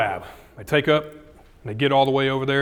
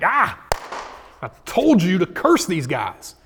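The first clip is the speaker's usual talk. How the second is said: higher, louder mock-angry outburst; arrabbiato; 5 semitones higher, 5 dB louder than usual. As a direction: blow up in exasperated anger, acting it out. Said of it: "he's, like, so mad".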